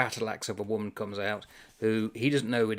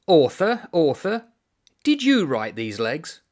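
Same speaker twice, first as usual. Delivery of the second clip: much louder, higher calls to the author; calling out; 5 semitones higher, 8 dB louder than usual. Acted. Irishman summoning the author, loud calling. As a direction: call the author out loudly.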